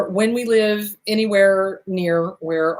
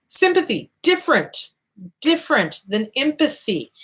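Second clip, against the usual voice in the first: normal talking voice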